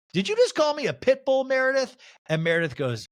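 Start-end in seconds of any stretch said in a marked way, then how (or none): 0.1-2.0 high-pitched indignant challenge; Angie K impression. Castmate confronting another over a pit bull insult, mock indignant. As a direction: put on an affronted castmate, pitched way up. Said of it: "Angie K goes".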